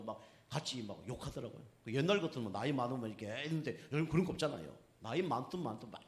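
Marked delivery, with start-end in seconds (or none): none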